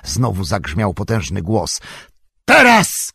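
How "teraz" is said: sharp, high, loud cry; shout. A narrator's reading. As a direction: bark the command over the din. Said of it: "krzyknął Gandalf". (wysoki, głośny okrzyk)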